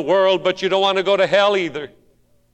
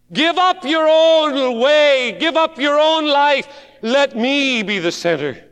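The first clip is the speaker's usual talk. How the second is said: preacher urging surrender; pleading preacher; 9 semitones higher, 5 dB louder than usual. Acted. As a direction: plead it from the pulpit. Preached appeal.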